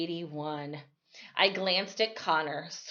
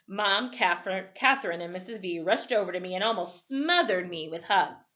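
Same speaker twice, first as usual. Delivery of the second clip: usual speaking voice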